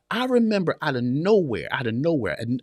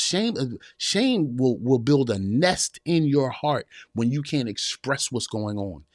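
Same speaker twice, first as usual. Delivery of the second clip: normal talking voice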